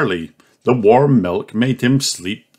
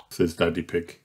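ordinary delivery

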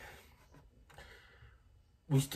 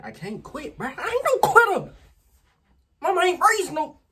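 hyped; 9 dB louder than usual. Real, not acted. Hyping himself up.

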